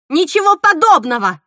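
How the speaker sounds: loud, high, furious denial; angry shout. A narrator's reading. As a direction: shout it down at once. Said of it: "крикнула Мила". (гневный громкий крик)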